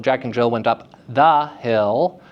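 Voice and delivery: "the" set apart and punched before "hill"; principal stress on the. Shown as a demonstration. Teacher explaining principal stress to class, oomph on "the". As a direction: give "the" extra oomph. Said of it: "what I call a principal".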